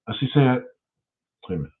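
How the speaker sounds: normal talking voice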